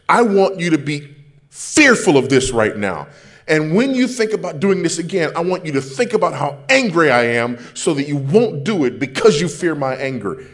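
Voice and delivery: loud, high mock rant at a child; imitating an angry parent. Preacher voicing a parent threatening a child, loud mock rant. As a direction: bellow it like a parent whose veins are popping. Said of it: "we're screaming".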